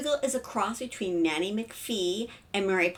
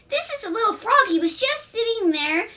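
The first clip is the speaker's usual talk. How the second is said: put-on very high child voice; excited little boy; 13 semitones higher, 4 dB louder than usual. A narrator's reading. Young son interrupts to show frog, excited child. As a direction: squeak it out like a small boy.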